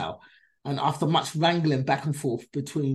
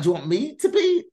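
pitched up high and a bit louder, baffled; confused question; 10 semitones higher, 4 dB louder than usual. Retold or imitated. Confused guest asking the producer what her role is, baffled and high. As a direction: ask it as if you can't believe the offer. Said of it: "so I was confused".

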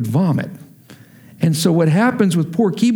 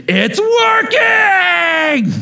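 very high, long-drawn ecstatic shriek; freaking-out child; 14 semitones higher, 3 dB louder, much slower than usual. Retold or imitated. Father acting his son's joy at the revived toy, elated shriek. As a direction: stretch it into a squealing, overjoyed kid's cry. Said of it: "He freaks out".

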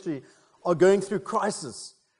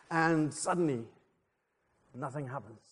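suspenseful build-up, then a soft anticlimax; dramatic tension; 3 semitones lower, 7 dB quieter than usual. Acted. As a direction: drop to a hush after a long pause. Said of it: "just building some dramatic tension".

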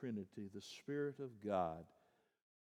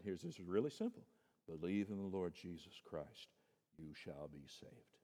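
ordinary talk

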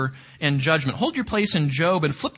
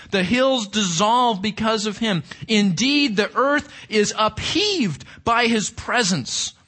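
building scripture proclamation; 4 semitones higher than usual. Acted. Reads Nahum with rising force.

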